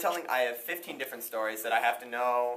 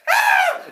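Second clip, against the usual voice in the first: very high loud scream; shrieking scream; 15 dB louder than usual. Acted. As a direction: shriek in panic. Fisherman screams.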